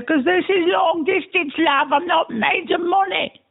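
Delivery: a put-on British accent, drunk and rambling; mock British accent. Drunk voicemail to himself, in a fake British accent.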